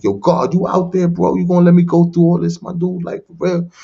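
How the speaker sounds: yelling up at God, frustrated and mad; angry call to God. Retold or imitated. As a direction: demand an answer from God, fed up. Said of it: "so I'm mad, I'm frustrated".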